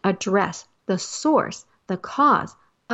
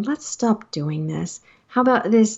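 normal talking voice

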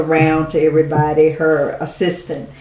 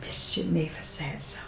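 quiet, softer reverent reply; soft, solemn answer; 10 dB quieter than usual. Retold or imitated. The old lady answering the speaker, quiet and solemn. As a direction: say it gently, like a secret.

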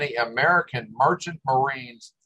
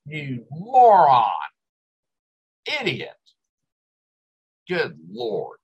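exasperated insult; slower than usual. Real, not acted.

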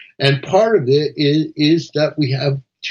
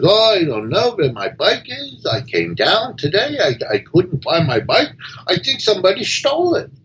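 higher, mildly puzzled rabbi voice; a rabbi who lost his bike, pitched up; rabbi in a joke; 6 semitones higher than usual. Acted. Rabbi tells the priest his bike is gone, higher.

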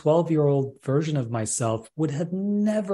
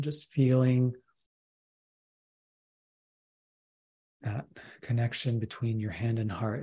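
right in your ear. Acted. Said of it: "I want to take you up on that offer to do a process".

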